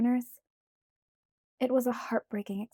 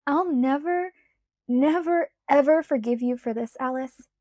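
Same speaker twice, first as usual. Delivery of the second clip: much louder, punching never; loud exasperated vow; 4 semitones higher, 8 dB louder than usual. A narrator's reading. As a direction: swear it at Alice, half-defeated. Bella vowing.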